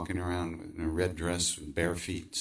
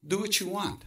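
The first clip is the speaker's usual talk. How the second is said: higher, calm pronouncement of the guru; guru's reply; 12 semitones higher than usual. Retold or imitated. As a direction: hand down the answer lightly. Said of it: "He said".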